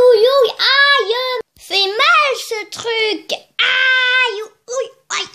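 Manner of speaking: loud, high, hammed-up aïe aïe ouille; cries of pain. Wounded [name] yelps on the way, loud hammed-up pain cries. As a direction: yelp in comic pain.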